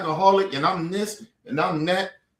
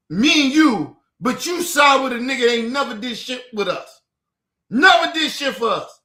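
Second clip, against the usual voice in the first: raised, heated protest; angry outburst; 3 semitones higher, 4 dB louder than usual. Real, not acted. Telling off someone who sided against him, loud and angry. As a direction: hammer the grievance out loud. Angry complaint about disloyalty.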